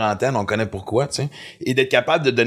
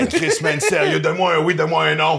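higher, pushy mock plea; exasperated demand; 4 semitones higher than usual. Dad imitating himself pressing his grunting son, exasperated. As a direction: sigh it out like a fed-up dad pressing for an answer.

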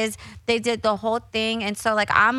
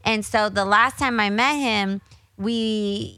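ordinary talk, nothing marked

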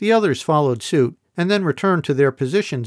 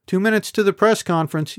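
higher voice called across the room; calling out; 6 semitones higher than usual. A narrator's reading. Ebeth calling out the countdown to the class, raised voice. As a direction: call the time to the group. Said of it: "Ebeth called out".

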